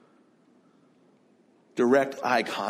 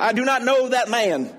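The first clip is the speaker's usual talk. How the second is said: hammed-up Texas accent, higher and louder; Texan drawl; 10 semitones higher, 4 dB louder than usual. Texan actor playing Peter in an Easter cantata, hammy Texas accent. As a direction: ham it up like a Texan church actor. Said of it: "a bad accent of an actor".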